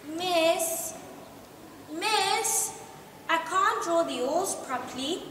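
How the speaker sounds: a hint of a whining pupil; child calling. A narrator's reading. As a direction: tug at the teacher's attention. Pupil interrupts the teacher.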